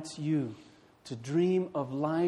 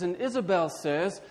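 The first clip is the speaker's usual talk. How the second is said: ordinary delivery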